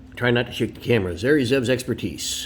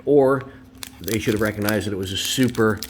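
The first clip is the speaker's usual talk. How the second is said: usual speaking voice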